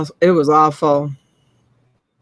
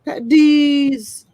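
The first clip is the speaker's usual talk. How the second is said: announcer voice; 7 semitones higher, 4 dB louder than usual. Acted. Repeats announcement.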